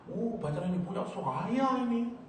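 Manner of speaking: high-pitched, happy showing off; delighted boasting. Acted. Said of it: "seakan-akan kalau kita senang".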